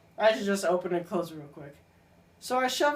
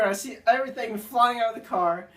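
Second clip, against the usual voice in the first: usual speaking voice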